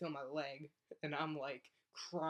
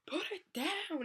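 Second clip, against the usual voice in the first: high-pitched hammed-up wail; mock-frantic cry, pitched way up; tearful scream; 13 semitones higher, 3 dB louder, slower than usual. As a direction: imitate your own desperate, crying plea. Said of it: "crying and weeping and screaming".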